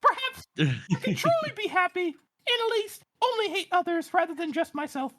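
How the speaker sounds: very high, squeaky put-on falsetto; tiny little voice. Host mocking the repressed letter writer, tiny high falsetto. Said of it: "in my tiny little voice".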